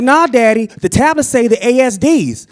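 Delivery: put-on high, loud child's protest; insistent little daughter. Small daughter insists to dad.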